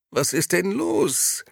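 high, slow, startled whine from sleep. A narrator's reading. Gigi woken by hammering, whining startled at the door. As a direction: drag it out high as if jolted awake. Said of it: "jammerte er erschrocken".

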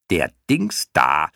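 slow, put-on creature voice; clown moth announcing. A narrator's reading. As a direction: announce it word by word to the others. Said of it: "teilte die erste Motte den anderen mit". (verkündende Mottenstimme, langsam und verstellt)

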